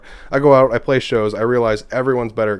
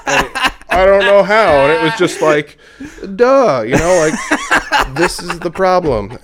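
laughing disbelief; 9 semitones higher, 6 dB louder, slower than usual. Real, not acted. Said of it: "But you're pissed".

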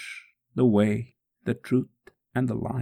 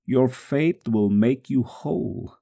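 usual speaking voice

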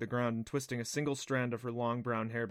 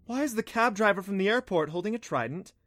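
high, louder, baffled question; confused; 8 semitones higher, 5 dB louder than usual. A narrator's reading. Dina asks about the cab driver with a trident, confused. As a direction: ask it in bewildered disbelief, pitched up. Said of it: "Dina asked in confusion".

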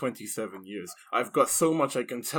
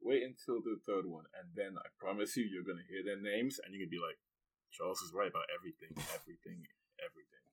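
quiet; 5 semitones lower, 12 dB quieter than usual. Pretend. Mock-gloating prediction.

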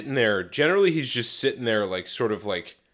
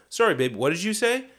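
retold attentive query; 6 semitones higher than usual. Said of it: "So I go".

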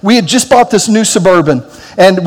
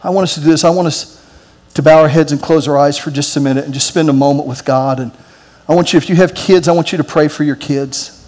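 lower, softer pastoral invitation to pray; prayer lead-in; 5 semitones lower, 4 dB quieter than usual. Acted. Pastor inviting the congregation to pray for their kids, hushed. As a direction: gently guide the room into prayer.

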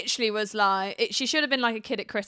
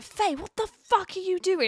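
shocked producer; 9 semitones higher, slower than usual. Retold or imitated. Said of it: "I was like".

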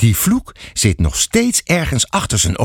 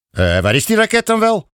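higher, impatient policeman's question; 10 semitones higher than usual. A narrator's reading. Police officer asking the father, impatient. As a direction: hurry him along, annoyed. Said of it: "vraagt de agent ongeduldig".

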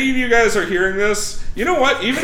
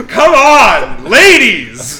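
laughing shout; 7 dB louder, much slower than usual. Real, not acted. Cheering, laughing call-out.

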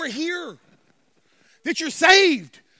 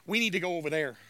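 low, softer lion line; lion talking to mate; 8 semitones lower, 4 dB quieter, faster than usual. Acted. Male lion to his mate, low understated voice. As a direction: drop low and quieter for the lion.

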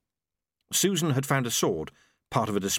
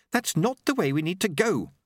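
a light snap, pitched higher; snapped; 9 semitones higher than usual. A narrator's reading. Susan irritated at Lobsang's route, a mild snap. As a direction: cut him off sharply but lightly voiced. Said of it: "Susan snapped".

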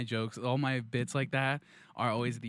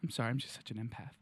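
low, soft, hurried mock apology; overcome empath apology; 4 semitones lower, 7 dB quieter, much faster than usual. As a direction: apologize quietly as if overcome by feeling. Empath overcome after the plea.

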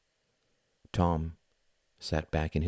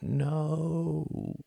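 high thin cry of no; sudden high protest; 11 semitones higher than usual. A narrator's reading. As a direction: cry out like a small child. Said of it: "Tom's thin voice crying out".

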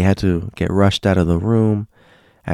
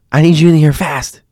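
urgent summons; 8 semitones higher, 6 dB louder than usual. Retold or imitated. Nurse urgently summoning colleague.

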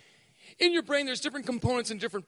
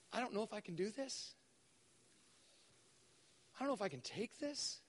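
quiet despair; 4 semitones lower, 15 dB quieter than usual. Retold or imitated. Retelling despair in the storm.

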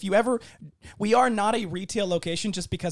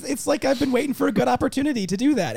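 excited; 9 semitones higher, 6 dB louder than usual. Real, not acted. Repeats it, even more eager.

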